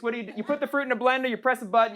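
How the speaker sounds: usual speaking voice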